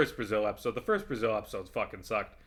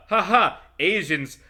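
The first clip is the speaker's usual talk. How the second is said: loud, higher mocking fake laughter; sarcastic mock laugh; 5 semitones higher, 7 dB louder than usual. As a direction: sneer at the lazy joke by playing it up. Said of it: "making fun of the".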